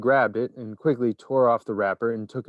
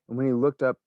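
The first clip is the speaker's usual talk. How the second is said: usual speaking voice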